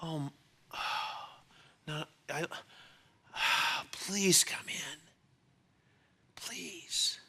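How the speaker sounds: a pleading, sighing whisper; whispered plea, sighs between words. Retold or imitated. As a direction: beg him softly, almost breathless. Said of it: "look at him like".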